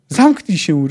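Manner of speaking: loud, angry roar; sharp, loud command. A narrator's reading. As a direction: roar him into silence. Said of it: "ryknął Puszczyk".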